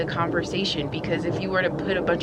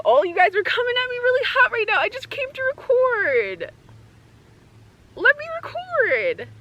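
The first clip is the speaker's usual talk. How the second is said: high, fast, exasperated whine; exasperated pleading; 13 semitones higher than usual. Real, not acted. Creator whining at the animals crowding her. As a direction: beg them to let you record.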